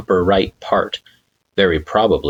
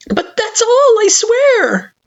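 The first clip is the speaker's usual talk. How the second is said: nervous pleading; 4 dB louder, slower than usual. Acted. Frisk pleading.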